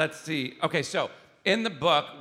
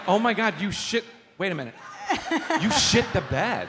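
mock realization; 8 semitones higher, 5 dB louder than usual. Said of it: "You're like".

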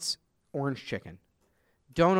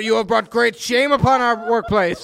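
mock scolding boss; 2 semitones higher, 8 dB louder than usual. Playing Yamamoto scolding staff.

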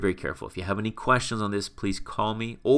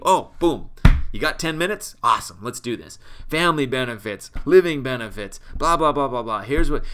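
bursting, louder pitch-up mock enthusiasm; eager sales rep; 3 semitones higher, 6 dB louder than usual. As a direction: play the keen sales rep who can't wait to pitch. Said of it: "They'll tell you".

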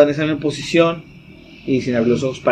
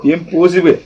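laughing. Retold or imitated. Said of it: "retelling his body's reaction".

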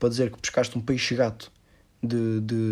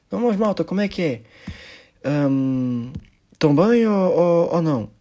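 imitating the comedian; 6 semitones higher, 5 dB louder, slower than usual. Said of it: "ele perguntou".

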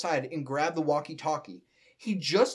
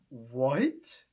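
lower, dim-witted questioning voice; Patrick; 4 semitones lower than usual. Acted. Confused starfish answering the announcer, dim voice. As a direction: let it rise in dull confusion. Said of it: "keep talking like Patrick".

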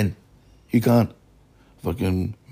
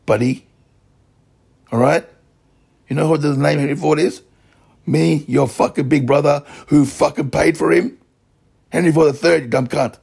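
raised, heated swearing tirade, pitch and volume up; angry rant; 5 semitones higher, 4 dB louder than usual. Real, not acted. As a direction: spit it out furiously at the offender. Ranting angrily at a rival.

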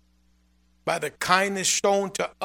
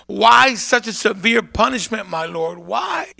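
loud, pleading questions to the Lord; preaching at full force, questioning heaven; impassioned plea; 4 semitones higher, 7 dB louder than usual. Retold or imitated. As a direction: cry out your why to God. Retold prayer questioning God, preaching force.

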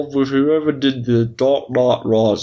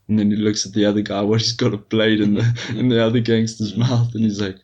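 ordinary talk

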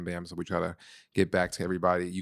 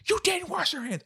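mock raised voice. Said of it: "raised her voice to me".

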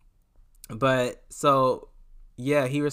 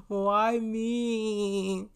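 high, drawn-out whine of self-pity; hammed-up woe-is-me wail; mock despair; 9 semitones higher than usual. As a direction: lament your luck in a high wail. Acting out her embarrassed despair.

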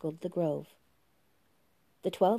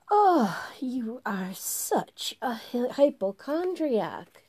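a high, slow sneer with a whispered break; languid September; 5 semitones higher, slower than usual. Acted. September teases April across the fire, high and slow. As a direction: drawl it out as bored September.

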